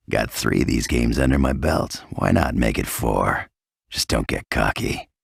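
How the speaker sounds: ordinary talk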